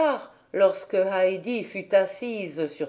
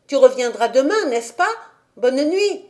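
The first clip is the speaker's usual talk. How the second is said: called out louder, Pierre shouting after her; calling out; 5 semitones higher, 5 dB louder than usual. A narrator's reading. Peter calls after Heidi as she runs, called out louder. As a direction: call across the pasture. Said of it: "Pierre lui cria encore".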